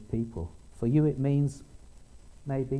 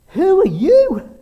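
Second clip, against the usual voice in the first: much higher, louder and slow astonished cry; astonished exclaim; 17 dB louder, much slower than usual. Retold or imitated. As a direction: blurt it out in utter amazement. Rabbi astonished at reader.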